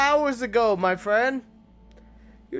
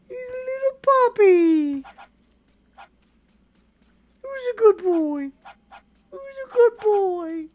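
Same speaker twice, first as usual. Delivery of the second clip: talking to a dog; 10 semitones higher than usual. Real, not acted. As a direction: coo sweetly, voice way up. Cooing over wolf pup.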